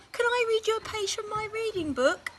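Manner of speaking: put-on high child's question; Laura asking mum; eager girl. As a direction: ask sweetly like a little girl. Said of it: "she asked".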